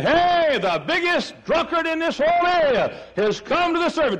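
high, ringing exclamation; acted astonished shout. Congregation announcing the town drunkard came, high excited cry. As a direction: cry out the big news at high pitch.

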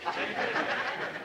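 a quiet, voiceless chuckle; a short, hushed laugh under the breath; soft laugh. Real, not acted. Preacher laughs at the pitcher joke, quiet chuckle.

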